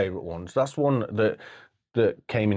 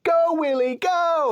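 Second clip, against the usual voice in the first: put-on much higher, loud child's cheer; mock cheering; 3 dB louder, slower than usual. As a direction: cheer the whale on like a boy. Kid cheering in the film.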